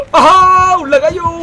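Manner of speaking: shout. Real, not acted. Fleeing upstairs.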